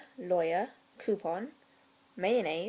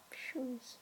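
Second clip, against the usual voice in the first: whispered punchline; 4 semitones higher, 15 dB quieter than usual. Real, not acted. Whispered punchline after pause.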